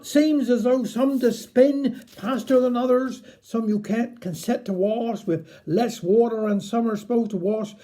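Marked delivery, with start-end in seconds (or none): none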